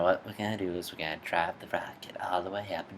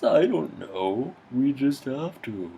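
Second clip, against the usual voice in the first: dim henchman; 5 semitones higher than usual. Acted. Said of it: "the villain".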